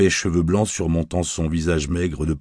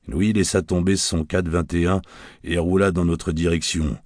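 usual speaking voice

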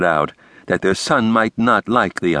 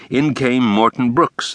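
plain reading voice

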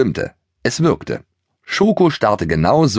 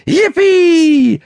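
very high, loud cheer of joy; pony whooping with glee while bucking; jubilant whoop; 7 dB louder than usual. Acted. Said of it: "Jauchzte Keks".

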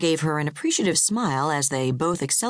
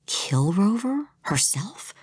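horrified whisper; 5 semitones higher, slower than usual. A narrator's reading. Horrified inner thought.

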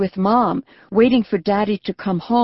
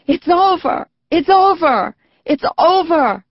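high, loud joyful cries; joyful shouting; 9 semitones higher, 4 dB louder than usual. A narrator's reading. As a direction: shout it out laughing and crying. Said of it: "They began to laugh and cry".

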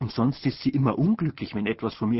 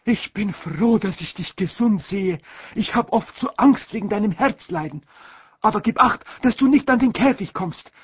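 higher, louder fussy aunt; gush, then warn about the cage; aunt calling out; 9 semitones higher, 5 dB louder than usual. A narrator's reading. Aunt greeting the mother, high and fussy. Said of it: "hat gerufen".

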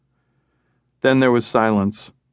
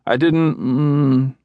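falling apart; 3 semitones higher, much slower than usual. A narrator's reading. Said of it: "words disintegrated into an inarticulate blur".

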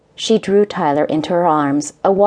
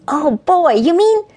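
put-on excited little boy; 11 semitones higher than usual. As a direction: bounce with delight. Excited child bouncing.

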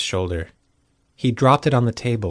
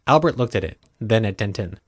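plain reading voice

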